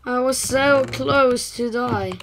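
normal talking voice

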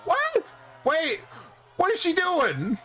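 very high, incredulous squeal; astonished. Real, not acted. Gamer reacting to a bizarre move, high astonished voice. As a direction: shoot up high in real disbelief.

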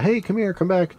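high mock yell at the dog; mock calling the dog. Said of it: "a normal person would probably be yelling at the dog".

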